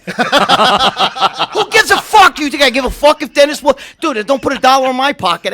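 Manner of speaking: loud, laughing scoff. Real, not acted. Host loudly scoffing at a bodybuilding question.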